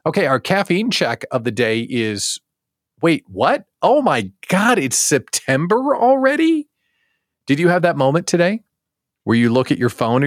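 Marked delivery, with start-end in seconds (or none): 3.0-6.7 high, hammed-up disbelief; mock surprise. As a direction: play it like sudden shock. Mock realizing date.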